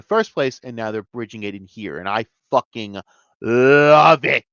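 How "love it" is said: loud, drawn-out gushing relish; emphatic delight. Real, not acted. Fan praising what the writers are doing, emphatic delight. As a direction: belt it out, stretching "love".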